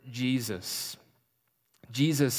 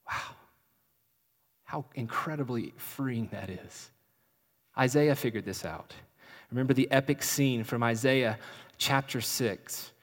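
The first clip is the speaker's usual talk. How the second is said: normal talking voice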